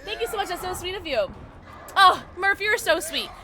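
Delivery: high, gushing thanks; touched gratitude. Real, not acted. Streamer thanking viewers who are so sweet, touched and grateful. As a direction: coo your thanks in a high voice.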